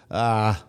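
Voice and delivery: wife's drawn-out sick "ah"; imitated sick moan. Husband imitating his vocal sick wife, mock moaning. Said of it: "she's a vocal sick person".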